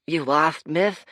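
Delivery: high, loud urchin voice; a grinning kid sizing up strangers; cheeky street kid. Acted. Street urchin teasing the lost fighters, high and cheeky.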